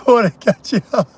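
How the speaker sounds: words breaking into a cackle; laughing. Real, not acted. Teasing listeners about getting caught, laughing. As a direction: say it through real laughter.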